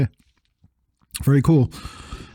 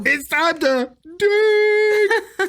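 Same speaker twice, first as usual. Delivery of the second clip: spooky voice; much slower than usual. Pretend. Segment jingle voice.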